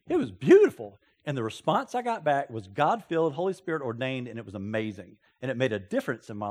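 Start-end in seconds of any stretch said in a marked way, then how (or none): 0.1-1.0 louder, higher burst of praise; light up at the memory; delighted. Real, not acted. Preacher praising a friend's prayerful reply, delighted. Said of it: "praising the friend's answer".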